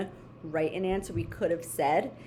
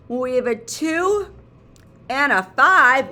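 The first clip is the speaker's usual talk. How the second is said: slow, louder rising suspense; building suspense; 4 semitones higher, 9 dB louder than usual. Pretend. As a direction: drag it out like a big reveal.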